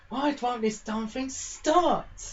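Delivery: fed up with a stalled scooter; frustrated. Acted. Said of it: "getting a little bit angry".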